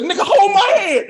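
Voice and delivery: hammed-up frantic plea; desperate yelling plea. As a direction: shout it through laughter, begging. Repeating plea to co-host.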